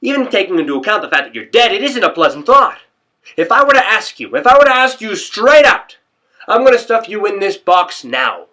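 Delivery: loud, high rant building toward a shout; agitated rant. Acted. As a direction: work yourself up over lying dead in a box. Agitated rant about being dead.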